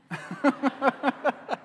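bursts out laughing high; real laugh at the punchline. Real, not acted. Preacher laughing at his daughter's question, high and real.

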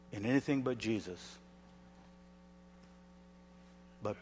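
a hushed, intimate plea; quiet tender conviction; soft earnest. Acted. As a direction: drop right down and speak softly. Soft earnest close of point.

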